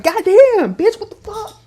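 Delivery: high, loud stunned yell; mock shocked yell at a gunshot; shocked outburst. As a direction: blurt it out in shock. Victims shocked by her shot.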